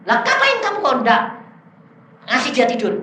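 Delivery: replaying an indignant telling-off; scolding. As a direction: demand an explanation in a high voice. Acts out scolding the spirit.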